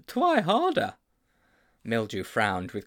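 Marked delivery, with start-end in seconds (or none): 0.1-0.9 Twy with a w for the r, high and pleading; lisping plea. Acted. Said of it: "implored Sponge".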